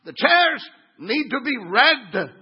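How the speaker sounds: loud, high, slow religious proclamation; hammed-up holy outrage, hammering each phrase; mock angry zealot. Angry religious churchgoer demanding red chairs, mock zealous shout. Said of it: "mean people who get angry in church".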